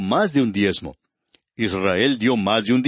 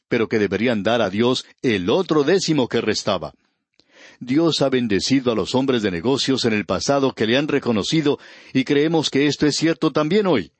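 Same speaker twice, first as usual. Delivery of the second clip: ordinary delivery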